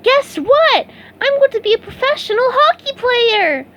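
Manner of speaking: bursting to tell; excited news. A narrator's reading. SpongeBob telling Patrick his news, thrilled squeal.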